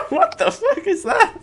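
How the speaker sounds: normal talking voice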